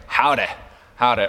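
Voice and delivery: low-pitched mock cowboy greeting; Western cowboy. Preacher imitating a Westerns fan greeting friends, cowboy twang. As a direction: drop the pitch for a Western howdy. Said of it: "you like Westerns".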